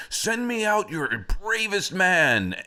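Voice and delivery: high, booming storybook giant challenge; hammed-up giant's ultimatum; threatening giant. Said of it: "the giant would come down there and he would say".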